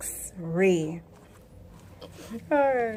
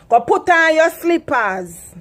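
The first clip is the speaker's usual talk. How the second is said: loud, higher Patois order, slow; Jamaican parent command; 6 semitones higher, 6 dB louder than usual. Shown as a demonstration. Jamaican mother ordering her child, loud Patois. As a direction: bark it like a Jamaican mum. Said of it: "Here in Jamaica, we say".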